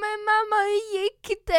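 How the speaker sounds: put-on high loud little girl asking; worried child. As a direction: voice a worried small daughter. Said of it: "hon bara". (hög orolig liten flicka)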